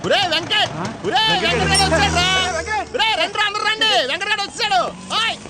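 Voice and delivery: loud excited shouting to the gang; calling out. Acted. Friend shouting to everyone that Venkat has come, loud and high. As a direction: call everyone over at the top of your voice, high.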